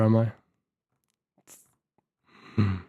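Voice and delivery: ordinary talk, nothing marked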